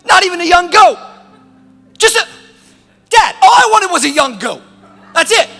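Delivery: put-on high, loud childish outburst; twelve-year-old tantrum. Grown son wants a goat from dad, childish tantrum. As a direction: yell like a kid denied a treat, rushing at the end. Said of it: "What is he, twelve years old?".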